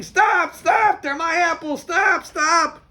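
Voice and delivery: high, loud yell, dragged out slowly; screaming stop. Retold or imitated. Peddler screams at people taking his apples, loud yell. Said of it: "And he's screaming".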